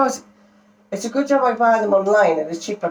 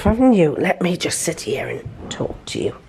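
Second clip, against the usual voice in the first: ordinary talk, nothing marked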